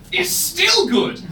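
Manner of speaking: high, slow, stretched punchline; mock surprise. Comedian springing the punchline on the crowd, high. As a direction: land it high and drawn out.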